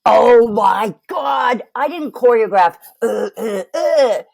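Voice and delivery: slow, loud, sobbing shout; a distressed sobbing wail, stumbling for words; anguished crying out. Shown as a demonstration. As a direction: cry out in desperate distress. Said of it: "if I go".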